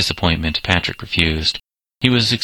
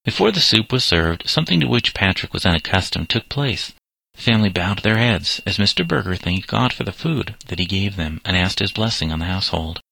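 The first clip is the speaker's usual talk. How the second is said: plain reading voice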